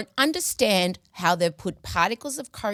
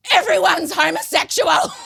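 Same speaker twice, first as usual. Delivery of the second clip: exclaiming; 9 semitones higher, 7 dB louder than usual. Retold or imitated. Retold panicked realisation.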